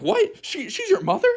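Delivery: very high-pitched mock shock; shocked realization. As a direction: act out stunned disbelief, voice leaping way up. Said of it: "I was thinking, like".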